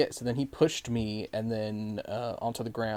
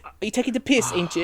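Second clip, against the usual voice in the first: put-on higher, louder Essex accent; 10 semitones higher, 5 dB louder, faster than usual. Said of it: "it's like the Essex".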